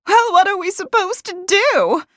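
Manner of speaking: very high, loud frantic question; panicked shout. A narrator's reading. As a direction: demand an answer at a shout. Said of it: "one of the women shouted".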